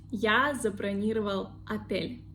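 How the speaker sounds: отель begun with A, "atyel"; unstressed O as A. Shown as a demonstration. Teacher repeating the rule's example, отель with A. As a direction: say отель with stress on the last syllable. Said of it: "O we pronounce like A".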